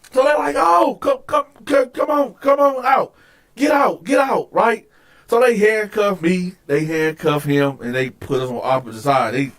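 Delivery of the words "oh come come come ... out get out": high-pitched guard commands; urgent ordering. Retold or imitated. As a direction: imitate guards hurrying someone out.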